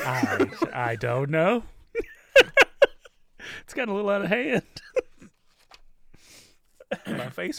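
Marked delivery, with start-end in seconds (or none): 0.0-4.6 laughing. Real, not acted. Laughing over how silly talk got.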